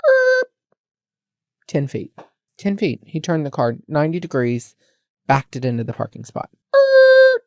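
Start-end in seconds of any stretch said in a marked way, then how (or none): 0.1-0.5 imitated brake squeak. Mimicking car stopping noise. 6.7-7.4 imitated brake squeak. Mimicking car stopping noise.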